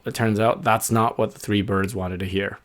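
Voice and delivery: ordinary delivery, nothing marked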